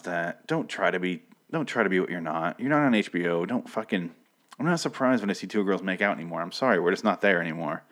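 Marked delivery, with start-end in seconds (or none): none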